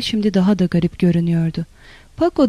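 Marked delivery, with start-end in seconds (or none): 2.2-2.5 high-pitched, lightly gleeful call; understated narrated joy; joyful. A narrator's reading. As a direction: call the name with a touch of delight. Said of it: "dedi sevinçle".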